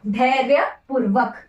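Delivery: धैर्यपूर्वक spelled out slowly, syllable by syllable; teacher dictating धैर्यपूर्वक slowly; slow model word. Shown as a demonstration. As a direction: say धैर्यपूर्वक slowly for students to copy. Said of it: "धैर्यपूर्वक होता है".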